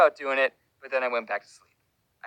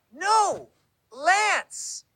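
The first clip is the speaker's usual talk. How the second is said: correcting caller; 8 semitones higher than usual. Retold or imitated. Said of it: "And she said".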